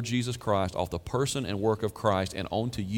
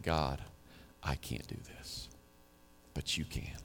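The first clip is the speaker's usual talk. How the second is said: low, hushed plea to God; desperate prayer, understated; 7 semitones lower, 11 dB quieter than usual. Acted. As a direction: softly admit helplessness in prayer. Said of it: "desperately saying".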